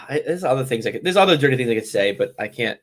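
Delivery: normal talking voice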